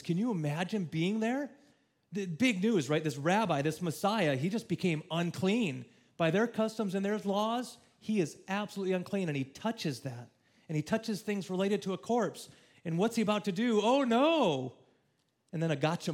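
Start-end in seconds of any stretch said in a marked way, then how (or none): 0.0-13.8 loud, high and urgent preaching; rising sermon fervour, loud and high; preaching at full force. Acted. 13.8-14.7 high, loud cry of mock dismay; hammed-up shocked crowd; mock alarm. Mock alarm at Jesus' act.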